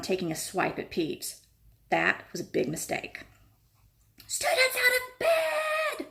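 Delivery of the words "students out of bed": high, slow mock bellow; poltergeist alarm. A narrator's reading. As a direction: raise the alarm with glee. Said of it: "Peeves bellowed".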